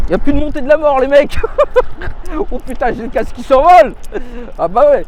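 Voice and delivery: loud, high, thrilled yelling with a laugh; excited shout. Real, not acted. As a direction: shout with glee over the wind. Climbing hill fast, helmet flying.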